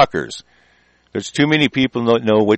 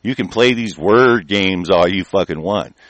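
usual speaking voice

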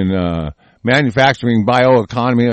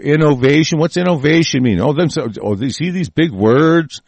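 ordinary talk, nothing marked

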